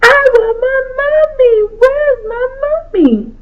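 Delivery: high, wailing sob through every word; a little girl bawling for her mother; crying child. Acted. Little girl crying at grandma's for her mommy. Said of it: "began to cry".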